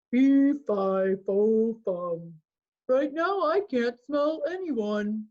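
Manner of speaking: the giant muttering to himself. A narrator's reading. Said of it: "muttering loud".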